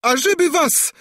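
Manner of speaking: very high, loud princess's outburst; sudden shriek. A narrator's reading. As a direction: shriek a curse out of nowhere. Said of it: "wrzasnęła nagle księżniczka". (nagły, wysoki wrzask)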